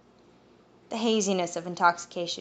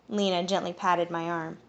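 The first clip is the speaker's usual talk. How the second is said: plain reading voice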